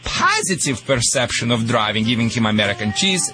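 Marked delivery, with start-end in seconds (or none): none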